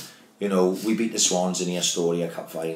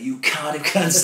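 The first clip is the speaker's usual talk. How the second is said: mock angry outburst; 8 semitones higher, 3 dB louder, slower than usual. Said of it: "I lost my fucking marbles".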